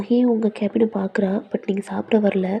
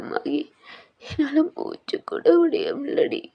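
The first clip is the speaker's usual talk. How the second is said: anguished crying outburst; 6 semitones higher than usual. Acted. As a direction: cry out in pain, breath failing. Heroine breaks down to friend. (வேதனையுடன் அழுது கதறல்)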